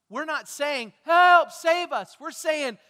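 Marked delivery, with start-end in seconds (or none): 1.0-2.2 loud, high, stretched plea; acted cry for help. Preacher shows what we are not saying, shouted plea. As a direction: shout out for rescue, slowly.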